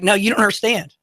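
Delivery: ordinary talk, nothing marked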